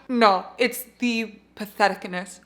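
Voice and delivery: ordinary talk, nothing marked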